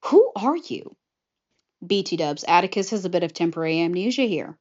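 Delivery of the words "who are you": high-pitched, puzzled question; mock bewilderment. Dazed Atticus to Rapunzel and Flynn, mock confusion. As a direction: act baffled by two strangers, pitched up. Amnesiac officer confused by strangers.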